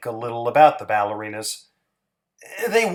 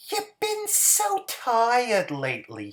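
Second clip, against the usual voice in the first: Hazel's voice, tender; 8 semitones higher than usual. Acted. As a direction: coax him gently to rest, high and sweet. Hazel's voice in story.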